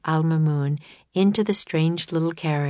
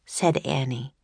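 ordinary delivery

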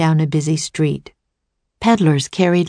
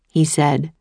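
ordinary delivery

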